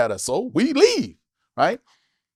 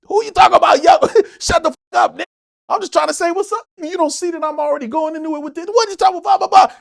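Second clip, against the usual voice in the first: high, loud pissed-off yelling; 12 semitones higher, 4 dB louder than usual. Retold or imitated. Yuck Mouth yelling back at the comic, high offended shouting. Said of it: "That pisses Yuck Mouth off".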